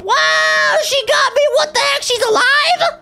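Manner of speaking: startled shout. Real, not acted. Kid startled when the prisoner grabs him, high shocked shout. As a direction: cry out in shock.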